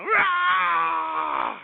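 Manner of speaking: high mock roar of rage. Mocking someone smashing grapefruit, high mock roar. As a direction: yelp it like a cartoon tantrum. Mock angry supermarket outburst.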